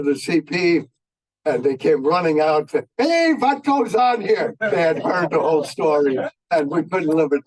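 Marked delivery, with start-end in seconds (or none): 3.0-4.5 put-on high, stretched-out German-accented heckle; German-accented imitation, pitched way up; terrible German accent. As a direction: tease with a drawn-out mock German accent. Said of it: "had a terrible German accent".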